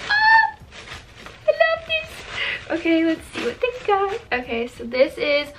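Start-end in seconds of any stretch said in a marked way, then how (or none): 0.1-0.6 very high, loud little squeal; delighted squeal. Real, not acted. Vlogger sees gifts from a small business, delighted squeal. Thrilled opening a package. 1.5-4.2 very high, drawn-out squeaky delight; thrilled squeak. Real, not acted. Vlogger unpacks a small shop's gift, thrilled squeak.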